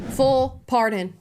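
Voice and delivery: leaning hard on "Full" to make the point; stress on FULL. Shown as a demonstration. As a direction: hit the word "Full" hard and take it slowly. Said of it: "Emphasis on the full".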